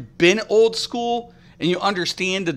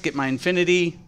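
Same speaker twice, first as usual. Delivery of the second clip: ordinary talk, nothing marked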